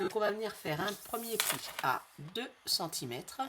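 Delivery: normal talking voice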